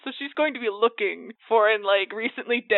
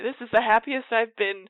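usual speaking voice

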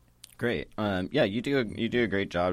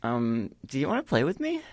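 put-on high, hopeful child voice; little girl voice; 8 semitones higher than usual. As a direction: ask sweetly like a small girl.

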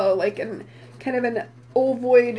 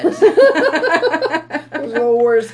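laughing; 5 dB louder, slower than usual. Real, not acted. Laughing at cohost's joke.

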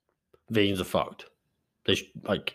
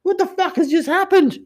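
high, loud, incredulous squawk; stunned disbelief; 4 dB louder, slower than usual. Retold or imitated. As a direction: act thrown completely off guard. His stunned reply to the accusation.

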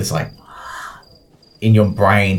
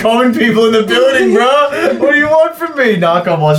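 high-pitched mock protest; mock exasperation; 13 semitones higher than usual. Game master pleading with players over two bystanders, mock exasperated. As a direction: protest way up high, as if put upon.

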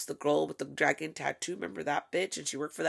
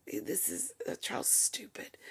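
whisper; 5 semitones higher, 9 dB quieter, slower than usual. Real, not acted.

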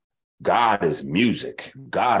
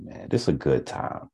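normal talking voice

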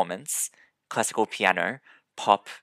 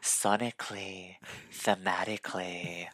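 fading into a whisper; reviewer buzzwords; 7 dB quieter than usual. Pretend. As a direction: list critic jargon, trailing off quiet. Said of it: "YouTube music reviewers".

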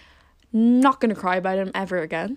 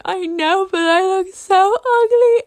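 high, tearful wail, louder at the end; reliving the sobbing complaint, pitched way up; whiny crying; 11 semitones higher, 7 dB louder, slower than usual. Retold or imitated. To her mom about the fringe, a high tearful whine. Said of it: "I was actually, like, crying and crying".

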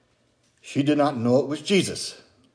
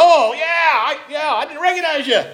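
higher, startled oh yeah of recognition; sheepish surprised greeting, pitched up; 12 semitones higher, 3 dB louder than usual. Retold or imitated. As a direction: catch yourself, surprised you didn't know them. Meeting a hometown acquaintance.

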